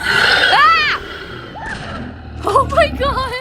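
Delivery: high shriek then gasped oh my god; frightened scream. Real, not acted. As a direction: scream as something jumps out. Monsters wake up suddenly.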